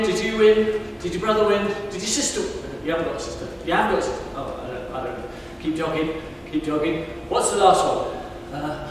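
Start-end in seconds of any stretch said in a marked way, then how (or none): none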